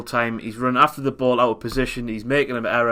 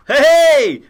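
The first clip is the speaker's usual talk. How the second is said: mock keeper yell; 13 semitones higher, 11 dB louder than usual. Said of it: "who just goes".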